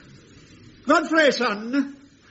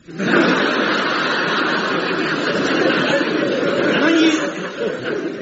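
sly laugh; 7 semitones higher than usual. Acted. Laughing down the phone.

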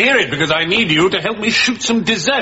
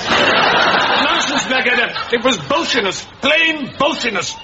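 high-pitched indignant bluster; blustering dismissal; 7 semitones higher than usual. Acted. General overrules captain on mutiny, high blustering dismissal. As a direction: scoff it away in a high voice.

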